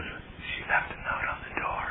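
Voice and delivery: hushed inner voice, breathy and low; the obsessed inner voice whispering a hope. Retold or imitated. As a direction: whisper it like a voice in your head. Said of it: "that voice goes".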